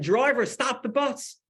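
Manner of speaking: high, loud drawn-out cry for help; storyteller acting a trapped scream; retold scream. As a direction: yell out slowly to the driver, imitating her panic. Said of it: "I started screaming".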